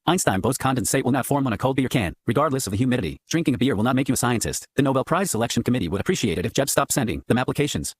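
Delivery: ordinary talk, nothing marked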